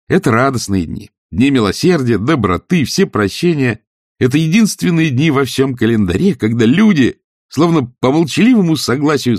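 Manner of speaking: high, animated, heartfelt oration; impassioned nephew. A narrator's reading. Nephew defending Christmas to his uncle, impassioned. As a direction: build it fervently toward a toast.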